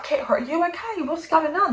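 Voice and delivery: a hint of a worried mother at midnight; foster mum. Foster mum finding Kit crying in the kitchen, soft, high concern. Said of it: "She's like".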